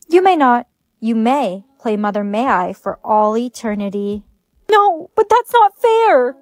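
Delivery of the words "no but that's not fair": whiny protest. Acted. Protests losing the game.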